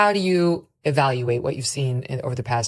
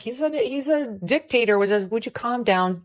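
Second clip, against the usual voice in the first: mocking; 7 semitones higher than usual. Mocks the freak-out crowd.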